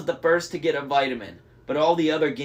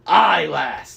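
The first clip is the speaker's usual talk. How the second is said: louder, rough pirate voice; pirate growl; 8 dB louder than usual. A narrator's reading. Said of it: "the big man growled".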